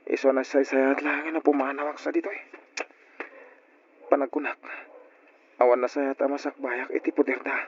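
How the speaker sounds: low, soft voice-over thoughts; quiet inner monologue. Acted. Boy thinking alone of leaving home, soft voice-over. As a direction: muse to yourself about running away.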